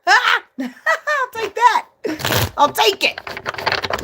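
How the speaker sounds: high giggly words; laughing. Real, not acted. Pleased with her tarot card, laughing.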